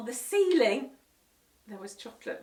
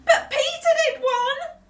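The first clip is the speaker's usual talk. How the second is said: much higher, louder wailing protest; howling whine; 13 dB louder than usual. Acted. Henry protesting to parents, howling whine. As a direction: howl the unfairness. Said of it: "howled Henry".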